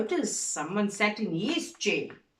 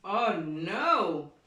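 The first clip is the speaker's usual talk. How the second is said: usual speaking voice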